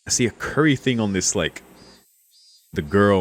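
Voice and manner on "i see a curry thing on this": ordinary talk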